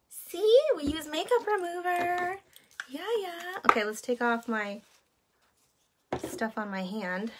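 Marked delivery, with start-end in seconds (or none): 0.1-3.6 high sing-song voice for a little one; baby talk. Acted. Talking to baby.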